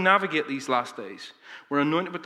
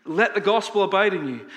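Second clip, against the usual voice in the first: raised, ringing sermon refrain; preaching; 6 semitones higher, 5 dB louder than usual. Acted. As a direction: proclaim it to the congregation.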